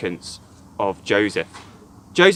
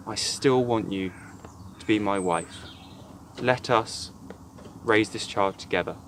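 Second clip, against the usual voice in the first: gentle, tender promise; 4 semitones lower, 8 dB quieter than usual. Acted. Joseph tenderly proposing to Mary.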